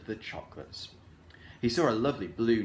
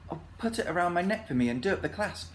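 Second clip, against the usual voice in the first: put-on a lightly higher girl's voice; a girl asking sweetly for help; Franny voice; 6 semitones higher, faster than usual. A narrator's reading. Franny asking Rick to fasten necklace, light girl voice.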